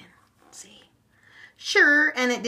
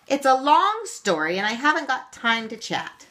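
stubborn; 8 semitones higher, 5 dB louder than usual. A narrator's reading. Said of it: "said Lucky Old Leprechaun stubbornly".

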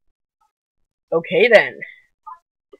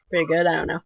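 normal talking voice

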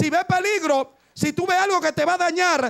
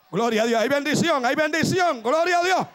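ordinary talk

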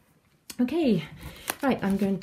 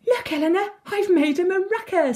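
high, excited little announcement; 12 semitones higher, 4 dB louder than usual. A narrator's reading. Sylvie showing Eleanor her new maraca, excited. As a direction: show off your discovery, pitched right up. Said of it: "says Sylvie excitedly".

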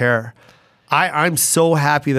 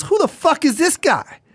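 high-pitched retold disbelief; astonished demand; 13 semitones higher than usual. Host to the show's owner after the set, astonished demand. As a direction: demand it in high, mock outrage.

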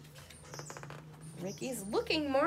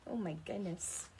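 ordinary delivery, nothing marked

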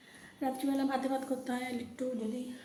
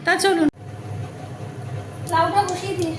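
raised voice over background noise; speaking loudly; 6 semitones higher, 8 dB louder than usual. Real, not acted. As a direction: push your voice over a barking dog. Talking over the barking dog.

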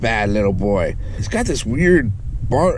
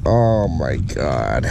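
low, slow groan of dismay; real weary exasperation; 11 semitones lower, much slower than usual. Real, not acted. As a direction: drag it out, pitch sinking. Reel seized completely.

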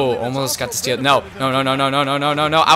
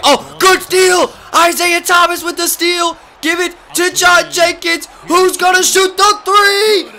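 much higher, loud commentary yell; excited announcer shout; 14 semitones higher, 5 dB louder than usual. Real, not acted. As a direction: scream the fast break like a TV announcer. Yelling live play-by-play.

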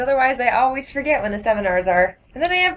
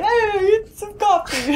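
Italian Cookie Monster; 8 semitones higher, slower than usual. Pretend. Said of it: "Is he slightly Italian?".

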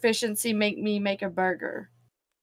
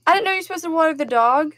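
surprised; 7 semitones higher, 7 dB louder than usual. Real, not acted. Shocked at dog watering.

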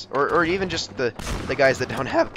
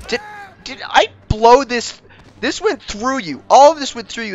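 indignant disbelief; 10 semitones higher, 9 dB louder than usual. Pretend. Player to an enemy the blast went through, indignant disbelief.

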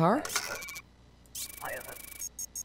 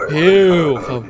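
shouting; 11 dB louder than usual. Acted. Shooing entrant away, louder.

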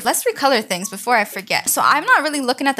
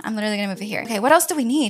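normal talking voice

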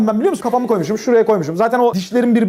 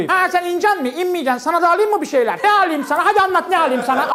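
chatty auntie; 5 semitones higher than usual. Retold or imitated.

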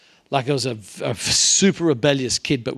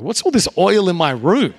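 put-on surprised teen; 6 semitones higher, 7 dB louder than usual. Teen discovers oil crosses.

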